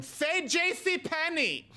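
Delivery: high, slow mock designer voice; Versace voice. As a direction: coach him sweetly like a fussy designer. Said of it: "Versace would tell him".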